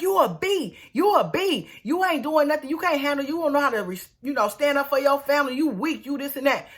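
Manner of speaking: high-pitched mock scolding; checking him. Sarah scolding Michael for being weak, checking him. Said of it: "so Sarah checking him like".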